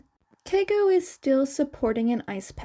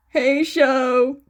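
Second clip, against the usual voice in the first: louder call to the next room; 7 dB louder than usual. A narrator's reading. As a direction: call out to him, friendly. Calling out to brother.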